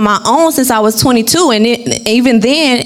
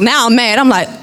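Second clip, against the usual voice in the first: ordinary talk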